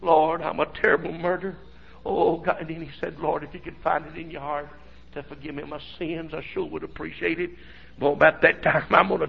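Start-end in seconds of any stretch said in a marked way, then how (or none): 0.0-7.5 much softer, broken praying; a repentant murderer prays quietly through tears; crying prayer. Retold or imitated. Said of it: "that man cried and said".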